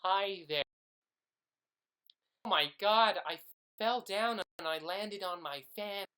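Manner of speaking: high, loud hammed-up American actor voice; American accent. Pretend American actor auditioning, loud high American accent. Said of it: "Could you imagine me as ... an American actor?".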